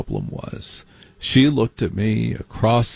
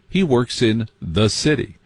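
normal talking voice

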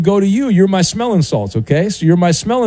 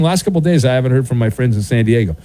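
normal talking voice

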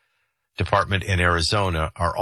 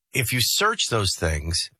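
ordinary delivery

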